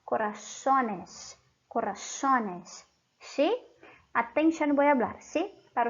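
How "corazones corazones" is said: corazones slowly, "co-ra-SO-nes", twice; correct plural. Shown as a demonstration. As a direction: draw out corazones with no i-glide. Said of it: "este es el plural correcto".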